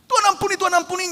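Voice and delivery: loud, high mock plea for mercy; hammed-up desperate begging; crying out to God for pardon; loud pleading. Acting a guilty worshipper pleading.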